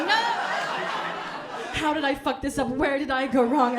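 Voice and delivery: mock despair. Inner panic quoted.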